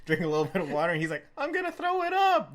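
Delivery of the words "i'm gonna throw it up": put-on much higher, louder whiny drunk voice; mock drunk whine. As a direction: whine like you're about to puke. Drunk friend about to vomit.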